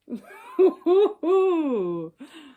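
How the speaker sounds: a real delighted laugh. Real, not acted. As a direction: burst of high loud laughter. Teased about her age.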